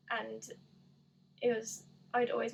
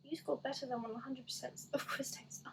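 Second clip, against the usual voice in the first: whispered reading; 5 dB quieter than usual. Real, not acted. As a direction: whisper the quiz result, much softer. Reads quiz result in whisper.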